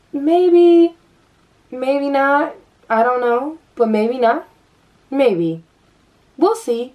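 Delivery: high, sing-song dismissive mimic of the roommate; mock-breezy roommate, pitched well up. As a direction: toss it off airily, teasing her. Roommate brushing her off, mocked.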